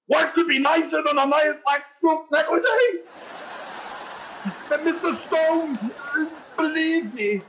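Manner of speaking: selling it desperately, voice climbing; nervous high pitch. Acted. Conklin nervously pushing the gift.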